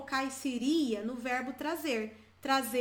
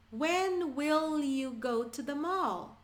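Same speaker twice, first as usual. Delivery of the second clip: slower model line; much slower than usual. Shown as a demonstration. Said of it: "teacher models sentence".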